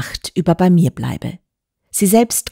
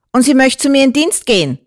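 high, incredulous interjection; calling out; 8 semitones higher, 4 dB louder than usual. A narrator's reading. Landlady cutting in on the doctor, calling out high. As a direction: break in with a raised, high landlady voice. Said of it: "rief die Wirtin dazwischen".

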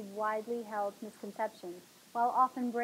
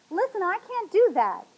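high, loud protest; yelling; 9 semitones higher, 10 dB louder than usual. A narrator's reading. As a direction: yell your refusal through the door. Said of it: "I yelled".

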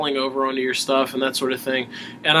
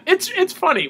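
ordinary talk

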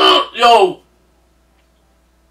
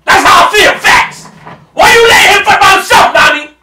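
much higher and louder, outraged; angry shout; 11 semitones higher, 8 dB louder than usual. Real, not acted. Fan yelling at Nami for not helping Luffy, angry shout. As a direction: scream the question at her.

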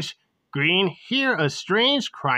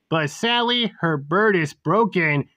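ordinary delivery, nothing marked

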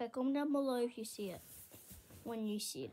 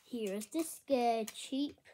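normal talking voice

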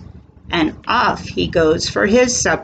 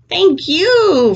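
higher, louder thanks; duck voice; 10 semitones higher, 4 dB louder than usual. Acted. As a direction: quack out a thank you. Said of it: "says the ducks".

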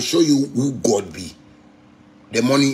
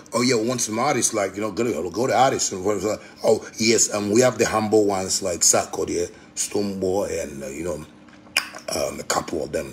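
lower, softer mock of the foreigners; 7 semitones lower, 4 dB quieter than usual. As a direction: mimic them, quiet and dismissive.

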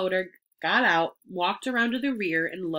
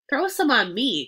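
put-on high, loud boyish plea; eager cry; 5 semitones higher, 6 dB louder than usual. A narrator's reading. Boy wants cold water thrown too, eager cry. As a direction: call out eagerly, pitched up and loud. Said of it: "cried Avery".

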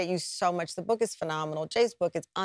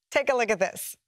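normal talking voice

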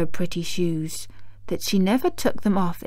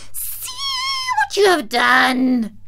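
witch's scream; 11 semitones higher, slower than usual. A narrator's reading. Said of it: "she screamed".

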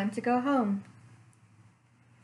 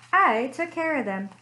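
ordinary delivery, nothing marked

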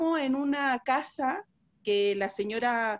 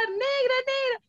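high-pitched calling out for help; mock panicked yell; 13 semitones higher, slower than usual. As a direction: shriek the friend's name in panic. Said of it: "me empezó a gritar". (amiga que grita desde la ducha, chillido agudo)

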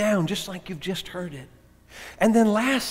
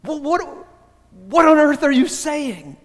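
startled, rising pitch, blurting; blurted out; 7 semitones higher, 4 dB louder than usual. Retold or imitated. Friend blurts back at the woman in shock, high and startled. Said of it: "he just sort of blurted out".

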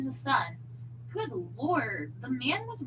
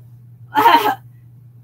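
laughing; 8 semitones higher, 18 dB louder than usual. Real, not acted.

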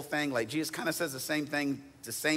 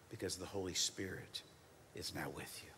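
soft, low whispered reassurance; 6 semitones lower, 12 dB quieter than usual. Acted. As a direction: let it fade to a comforting whisper. Tender close of sermon point.

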